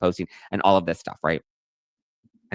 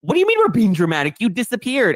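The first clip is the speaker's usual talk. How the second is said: high, loud, exasperated retelling; mock exasperation; 11 semitones higher, 5 dB louder than usual. Co-host retelling her exasperated reply to Donna, high and loud. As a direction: throw it back at her, fed up.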